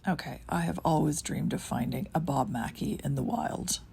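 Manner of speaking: normal talking voice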